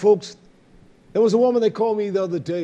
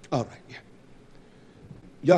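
whispered aside; 2 semitones lower, much slower than usual. Real, not acted. Aside to someone.

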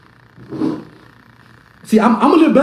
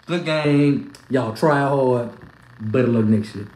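mock consolation; 9 semitones lower, slower than usual.

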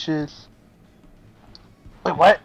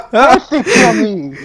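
laughing outcry; 13 dB louder, slower than usual. Real, not acted. Streamer laughing at his own death, loud and slow. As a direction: laugh through the protest. Laughing after being killed.

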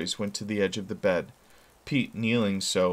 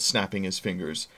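ordinary delivery, nothing marked

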